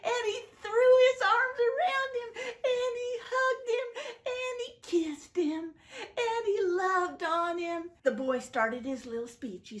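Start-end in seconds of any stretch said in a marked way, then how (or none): 0.0-7.9 choked-up storyteller; tearful telling. A narrator's reading. Children's Bible story, narrator near tears. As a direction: voice cracking with emotion, high. Father embraces returning son.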